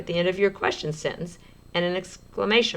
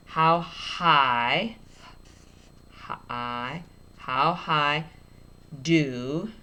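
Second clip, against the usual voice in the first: stretched out; much slower than usual. Shown as a demonstration. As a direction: sound out slowly. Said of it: "I'm gonna stretch it out".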